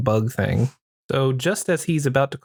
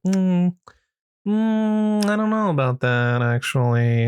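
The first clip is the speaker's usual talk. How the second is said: coy hint; 6 semitones higher than usual. Retold or imitated. Dom hints he is not straight.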